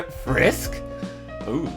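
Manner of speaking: ordinary delivery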